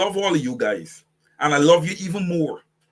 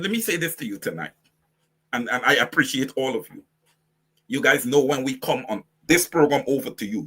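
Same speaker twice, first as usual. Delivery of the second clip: usual speaking voice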